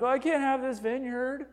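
high-pitched mock whine; whining and pouting. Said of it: "He starts whining and pouting".

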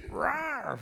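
small, high, soft mock roar; feeble lion-cub roar. Preacher imitates little Simba trying to roar, feeble high squeak. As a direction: squeak out a baby lion's weak roar. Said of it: "hardly anything comes out".

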